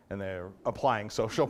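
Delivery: ordinary delivery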